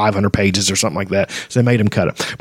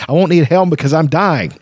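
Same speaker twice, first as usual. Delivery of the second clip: angry; 6 semitones higher than usual. Acted. Flint angrily insists.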